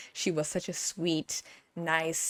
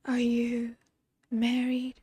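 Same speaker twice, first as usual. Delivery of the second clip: higher, slow and gentle; soft-spoken Susan; 6 semitones higher, slower than usual. Retold or imitated. Imitating Susan asking Joe if he's married, higher and soft. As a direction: ask it sweetly and shyly. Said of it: "She's like".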